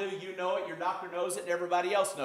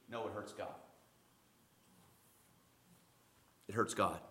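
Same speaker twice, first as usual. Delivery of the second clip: very low, soft correction; hushed gravity; 10 semitones lower, 14 dB quieter than usual. Real, not acted. Pastor telling congregation that sin hurts God, hushed gravity. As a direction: drop low and quiet to let it land. Grave correction after quoted excuse.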